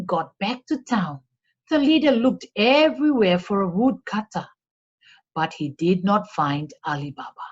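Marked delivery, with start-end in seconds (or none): none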